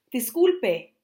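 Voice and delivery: gasp, then a high loud Disculpe; sorry after bumping into someone; startled apology. Pretend. After bumping into a stranger, startled loud apology.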